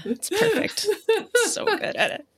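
high teasing praise; sing-song ironic praise; mock praise. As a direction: overdo the compliment playfully. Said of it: "teasing praise".

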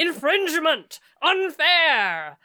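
loud high ghostly bellow. Acted.

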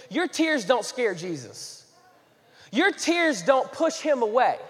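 slow, weighty pastor emphasis; slow earnest preaching. Acted. Pastor assures the congregation, slow and weighty. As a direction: lean on each word to the church.

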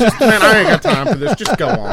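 high and loud, laughing through it; a cop too tired for this, waving him on; weary cop. Retold or imitated. Imitating the cop brushing off the sovereign citizen, laughing. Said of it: "the cop's just like".

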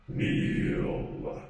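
breathy otherworldly command, unvoiced; unearthly voice. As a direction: whisper it like a ghost's order. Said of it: "He says in an unearthly voice".

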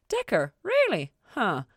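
Really jumps high and loud; Ella hearing Decker set the fire, surprised. Acted. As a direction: caught off guard, then impressed.

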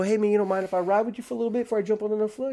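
fast, high-pitched request; retold breezy rapid ask; casual wheedling. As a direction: slip the favour in quickly. Wheedles for a ride.

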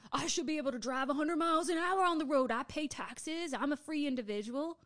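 mock entitled driver. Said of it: "you're not screaming about".

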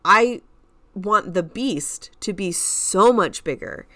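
normal talking voice

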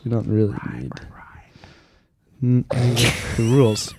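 whispered aside under the breath; whispering. Real, not acted. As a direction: drop to a whisper. Aside while reading rules.

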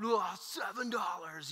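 slow, stretched-out delight over seven dollars; pumped kid. Retold or imitated. Preacher imitating his son thrilled with birthday money. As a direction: draw it out like a thrilled seven-year-old. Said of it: "he's super pumped".

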